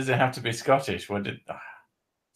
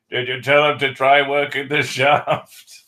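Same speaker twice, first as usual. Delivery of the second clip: raised, louder heckle from behind; background shout; 4 semitones higher, 6 dB louder than usual. Acted. Man voices someone interrupting from the background, shouted question. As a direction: pitch it up as if calling from across the room. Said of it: "then you hear from the background".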